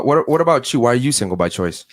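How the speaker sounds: normal talking voice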